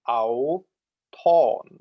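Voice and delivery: "auton" very slowly, pitch high then lowering; exaggerated circumflex accent. Shown as a demonstration. Lecturer repeating for students, "auton" slowed right down with circumflex pitch. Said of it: "the pitch on a circumflex accent starts high".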